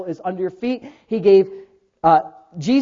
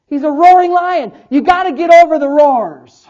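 raised, forceful sermon punchline; emphatic preaching; 8 semitones higher, 11 dB louder, slower than usual. Real, not acted. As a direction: drive it home to the congregation.

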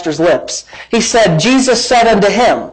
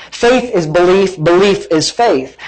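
ordinary talk, nothing marked

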